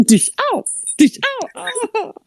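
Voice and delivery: smack noise, high comic ow; mock hit noises. Storyteller acting out playground dodgeball, mock pained yelps. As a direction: act out blows and yelps. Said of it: "He's just playing there like".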